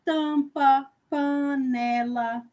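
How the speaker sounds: tampa loud, panela slow and spelled out; nasal example words. Shown as a demonstration. Teacher listing nasal words for pupils, slow and clear. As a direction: say tampa then panela slowly, stressing the nasal syllables. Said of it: "Palavras com M".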